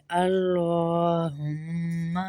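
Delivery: slow اللہم with a thick lam; heavy lam. Shown as a demonstration. Teacher recites the correct form for students. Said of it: "لام کو موٹا کریں گے".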